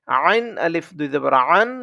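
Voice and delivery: আইন and আন squeezed from the throat; throat-pressed Ain. Shown as a demonstration. Teacher drills students on the letter Ain, আইন pressed in the throat. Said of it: "গলায় চাপ দিয়ে হবে".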